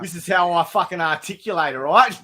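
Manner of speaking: smug Aussie bloke voice; hammed-up Australian; Australian accent. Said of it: "full tilt that impression".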